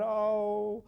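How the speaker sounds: ordinary delivery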